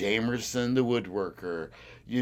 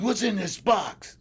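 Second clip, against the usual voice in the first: higher, slow, pressing question; slow, higher interrogating cop; mock cop demand; 9 semitones higher, 4 dB louder than usual. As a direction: lean on each word like a cop interrogating. Police interrogating him at knifepoint.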